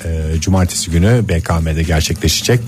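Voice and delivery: slow, measured station announcement; radio announcer. Acted. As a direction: read it like an on-air promo. Radio host announcement read. (radyo spikeri anons sesi)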